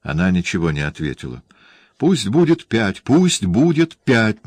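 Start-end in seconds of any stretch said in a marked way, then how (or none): 2.0-4.4 raised, emphatic repetition with bitter zeal; bitter fervour. A narrator's reading. Olivier flinging it back at silent Allegria, bitterly fervent. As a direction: insist on it bitterly, higher and louder. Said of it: "с горьким воодушевлением".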